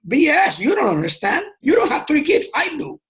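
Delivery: indignant dad brushing off a fake understanding; exasperated retort. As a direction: fire it back like a fed-up dad. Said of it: "I'm going".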